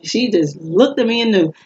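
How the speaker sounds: ordinary talk, nothing marked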